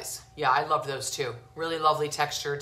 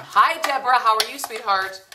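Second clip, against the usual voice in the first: high, loud hello to a viewer; excited shout-out to a fan; bright greeting; 5 semitones higher, 6 dB louder than usual. Real, not acted. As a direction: call out a happy hello.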